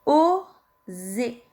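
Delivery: "Oser" with a long, held O; syllable dictation. Shown as a demonstration. Teacher dictating the second syllable, slow and drawn out. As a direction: stretch "Oser" slowly for dictation. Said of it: "Deuxième syllabe".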